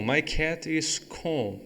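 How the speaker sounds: very slow, careful reading; slow model sentence for students; slow demo. Shown as a demonstration. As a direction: spell the sentence out slowly. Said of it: "eu chego e falo".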